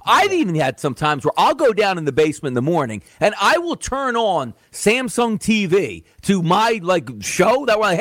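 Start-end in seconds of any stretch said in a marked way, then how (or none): none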